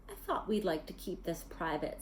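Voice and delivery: low, soft and cold; icy voice. A narrator's reading. Snape coldly answering Quirrell in the clearing, icy. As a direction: let it drip quiet menace. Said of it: "his voice icy".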